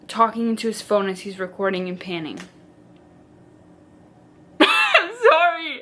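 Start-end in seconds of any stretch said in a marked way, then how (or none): 4.6-5.8 laughing. Real, not acted. Laughing at the cringe.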